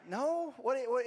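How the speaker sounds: high, puzzled "no, what?"; mock bewilderment, pitched well up; taken aback, reenacting it; baffled retold reply. Son's baffled reply to dad.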